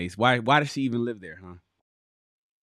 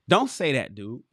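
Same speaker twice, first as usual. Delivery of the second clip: ordinary talk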